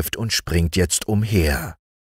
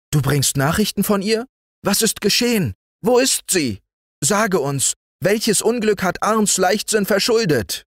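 higher, worried rush of questions; anxious family questions. Acted. Family crowding the messenger boy, high and anxious. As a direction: press the boy for news, frightened. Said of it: "umringte ihn die Familie schon mit Fragen".

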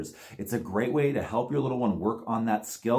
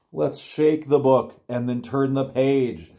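ordinary delivery

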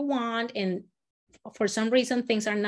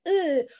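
flinch away from the fly; dodging ooh; 5 semitones higher than usual. Shown as a demonstration. Teacher dodging the fly like a fan. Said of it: "trying to avoid the fly".

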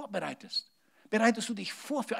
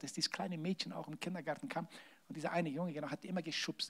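normal talking voice

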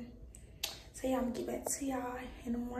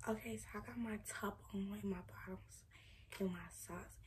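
very soft, keep it down; hushed whisper; 10 dB quieter than usual. Real, not acted. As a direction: whisper so nobody sleeping wakes up. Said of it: "I'm trying to whisper".

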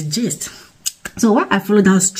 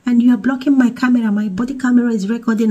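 higher voice, lightly imitating a calm officer; police lady reassuring; 7 semitones higher than usual. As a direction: imitate the officer soothing a crying mom, pitched up. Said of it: "So she was like".

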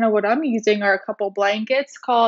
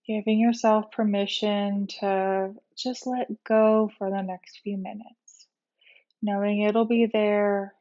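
guided meditation; 4 dB quieter than usual. Real, not acted. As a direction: ease them gently into rest, unhurried. Leads a guided relaxation meditation.

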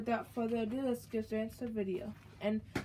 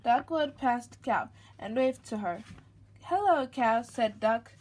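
lively, louder storybook read-aloud; reading to a child; 7 dB louder, slower than usual. A narrator's reading. Mother reading a picture book to her baby, lively storybook voice. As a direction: read it out brightly for the little one.

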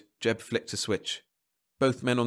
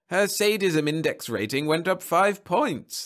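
high, slow, lilting explanation; genial marriage counsellor, upbeat; 8 semitones higher, 4 dB louder, slower than usual. Acted. Counsellor explaining test results to husband, upbeat. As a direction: explain it like wonderful news, stretched out high.

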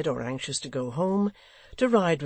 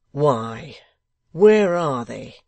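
much louder, slow impatient demand; 10 dB louder, much slower than usual. Acted. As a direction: press him, fed up. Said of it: "he returned impatiently".

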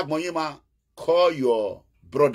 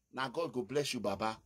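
much softer, almost under the breath; soft blessing; 14 dB quieter than usual. Real, not acted. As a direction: bless him quietly, as a personal aside. Blessing Osho quietly, then repeating.

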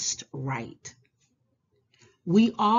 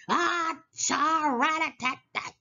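high, quick rattling chatter sounds; playful chatter noise; 10 semitones higher than usual. Pretend. Reading to children, a high playful chattering.

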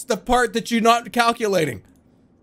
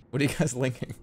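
usual speaking voice

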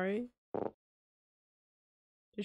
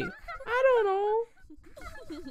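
silly sing-song; much slower than usual. Pretend. Playful answer to game question.

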